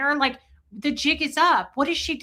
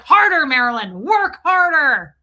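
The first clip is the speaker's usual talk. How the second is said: mock taskmaster; 4 semitones higher, 10 dB louder than usual. Aaron ordering Marilyn to delete faster, loud mock order. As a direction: drive her on like a slave driver. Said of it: "He's like".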